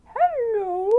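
very high, drawn-out kitty voice; purring cat's hello. A narrator's reading. Storyteller voicing the cat greeting the farmer, high cat hello. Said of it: "purred and let out a".

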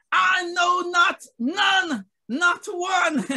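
high, loud preacher's proclamation; God speaking. Acted. Preacher voicing God to his people, emphatic proclamation. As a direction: declare it as God, each phrase ringing out. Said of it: "And God is now speaking".

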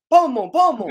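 loud, high urging shout; excited cheering. Real, not acted. Fan urging on the team, excited shout.

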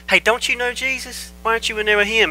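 high-pitched challenging questions; mock questioning onlooker, pitched high; nosy bystander. Acting out accusers questioning Peter.

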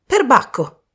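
high-pitched, impressed outburst; exclamation. Acted. Michael on seeing the red stone, exclaiming. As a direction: burst out in amazement. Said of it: "esclamò Michael".

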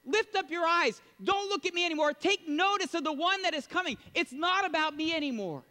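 high, loud urgent call; emphatic proclamation. Retold or imitated. John turns the crowd toward Jesus, emphatic. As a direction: point the whole crowd at him. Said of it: "he is emphatic".

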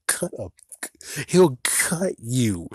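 slow, breathy whispered threat; menacing whisper. Pretend. Vlogger whispering the Grim Reaper's threat, slow menacing whisper. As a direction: hiss it slowly like a creeping reaper.

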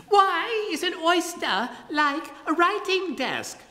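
very high, slow falsetto; high falsetto riddle. Acted. Tradesman poses his riddle in high, slow falsetto.

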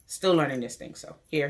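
ordinary delivery

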